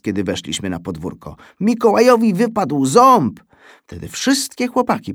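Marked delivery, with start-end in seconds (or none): 1.6-3.4 wysokie, donośne obwieszczenie chłopca; okrzyk. A narrator's reading. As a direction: wykrzycz nowinę do całego podwórka. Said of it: "krzyknął Alcest". (po polsku: głośne wołanie do kolegów)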